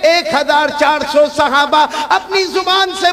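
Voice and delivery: loud, rousing preacher leading the crowd; full-force sermon chant; call-and-response preaching. Acted. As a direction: drive the crowd to answer back.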